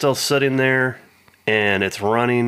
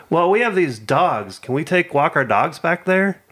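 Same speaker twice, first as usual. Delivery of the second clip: mock lady voice; 7 semitones higher than usual. Imitating the lady asking to walk her dogs, higher. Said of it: "lady asks about her dogs".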